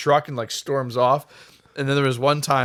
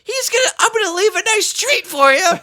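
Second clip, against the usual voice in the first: put-on high, louder old-lady voice; sly mock threat from a cranky old woman; mimicking Arlene; 7 dB louder than usual. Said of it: "she's just like".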